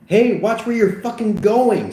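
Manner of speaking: high, loud angry challenge; indignant high-pitched shout; irate partygoer. Acted. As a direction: snap it at someone shoving past. Angry partygoer barks at someone.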